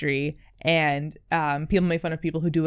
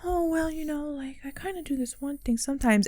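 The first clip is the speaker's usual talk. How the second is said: soft, high, sheepish mock voice; self-minimizing; 7 semitones higher, 8 dB quieter than usual. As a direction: play down your own skill, small and high. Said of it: "we're minimizing ourselves".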